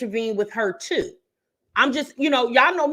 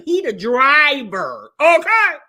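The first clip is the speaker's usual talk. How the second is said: slow, louder, rising insistence on "driver", big "okay"; emphatic point; 7 semitones higher, 7 dB louder, slower than usual. Real, not acted. To viewers about Gerard's job, a slow loud emphatic insist.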